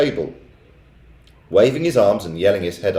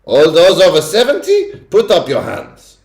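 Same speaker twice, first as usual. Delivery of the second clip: sudden bark; 10 semitones higher, 8 dB louder than usual. A narrator's reading. Witch ordering the old witches, barking loud. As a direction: bark it at them. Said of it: "she barked suddenly".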